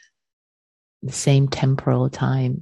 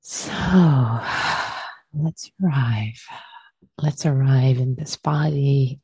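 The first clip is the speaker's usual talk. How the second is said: guided meditation. Acted. Teacher leading students to arrive and release, guided meditation. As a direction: guide them gently into the body, unhurried.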